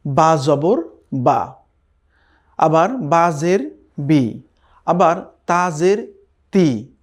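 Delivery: slow syllable-by-syllable reading, বা জবর বা; slow spelling drill. Shown as a demonstration. Teacher spells out syllables for beginners, very slowly. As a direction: spell each letter and vowel mark out slowly. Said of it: "আমরা এর আগে যেমন জেনেছি".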